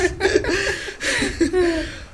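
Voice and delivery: high, gleeful burst of laughter; delighted cackle at the story; hearty laugh. Acted. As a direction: laugh at the memory. Laughs after retelling the outburst.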